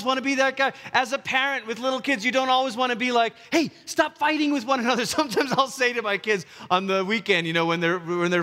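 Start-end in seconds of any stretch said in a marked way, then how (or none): 3.5-5.1 high-pitched mock telling-off; parental scolding. Parent to fighting little kids, mock scolding. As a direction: break up a squabble in a raised pitch.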